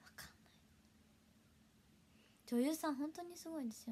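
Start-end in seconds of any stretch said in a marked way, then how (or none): 0.0-0.5 barely audible whisper. Real, not acted. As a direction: let it fade into a whisper. Trailing whisper after long pause. (消え入るようなささやき)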